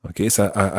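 usual speaking voice

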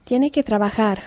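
ordinary delivery

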